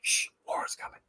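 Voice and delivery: hushed ghostly whisper. Retold or imitated. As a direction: breathe it like a secret warning. Ghost voice on recording.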